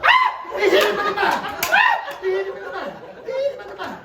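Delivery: high, loud mock shriek; screaming. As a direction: scream it out like an outraged onlooker. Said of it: "she's screaming up".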